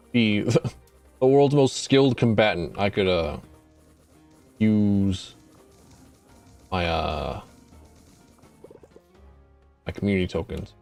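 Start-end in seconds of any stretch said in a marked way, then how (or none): none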